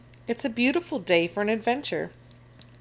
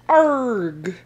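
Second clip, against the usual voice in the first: loud, higher "arg" yell; crew's yell; 4 semitones higher, 8 dB louder than usual. A narrator's reading. Pirate crew hit by a wave, yelling. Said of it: "yells the crew".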